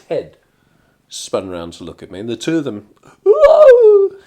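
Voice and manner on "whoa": high, loud shocked cry; shocked whoa. Retold or imitated. The two baristas seeing his face, shocked cry. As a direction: cry out in sudden alarm.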